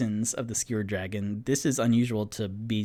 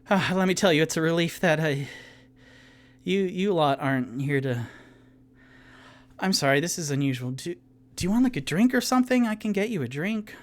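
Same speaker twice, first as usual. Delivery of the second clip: higher, fast, nervously eager barkeep; flustered bartender; 5 semitones higher, much faster than usual. Acted. Wounded bartender Ivy nervously offers the party a drink, higher flustered voice. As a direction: babble to please the kind strangers.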